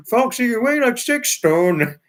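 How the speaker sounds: high, loud imitation of Trevor's accent; copying David copying Trevor's accent; mock Trevor accent. Retelling David imitating Trevor's accent, high and loud. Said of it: "He tried to do Trevor's accent".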